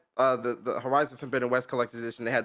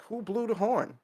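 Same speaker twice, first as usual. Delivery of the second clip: higher-pitched questioning voice; Atreus; 8 semitones higher than usual. Acted. Quoting Atreus from the first game, lightly voiced and higher. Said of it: "Atreus is like".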